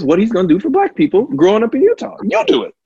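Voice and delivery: loud, high and rushing; emphatic urging. Real, not acted. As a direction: push it out loud with rising urgency. Heated advice to a congregant.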